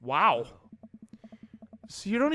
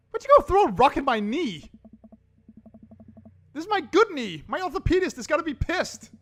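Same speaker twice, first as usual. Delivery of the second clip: higher, louder outraged whine; indignant whine; 9 semitones higher, 6 dB louder than usual. Acted. Character whining to Ernest about his good knee, high indignant. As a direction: complain like a fussy old guy.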